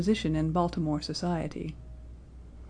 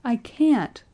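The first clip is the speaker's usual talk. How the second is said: high, loud, despairing moan; anguished, raised refusal; 6 semitones higher, 5 dB louder, much slower than usual. A narrator's reading. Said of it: "he moaned".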